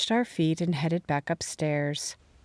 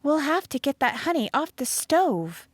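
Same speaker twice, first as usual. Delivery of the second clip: firm, higher declaration; emphatic; 10 semitones higher than usual. A narrator's reading. Molly deciding to clean the stove, emphatic. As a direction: lay down the plan firmly. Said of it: "Molly declared emphatically".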